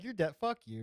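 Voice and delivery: hammed-up hostile snap; mock angry cop. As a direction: imitate a fed-up officer cursing out a driver. Imagines the cop's angry reaction.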